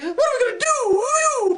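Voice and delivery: high, drawn-out cartoon wail; mock bear panic. All the bears fretting at what's coming, high mock wail. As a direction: stretch the last word into a high, comic wail.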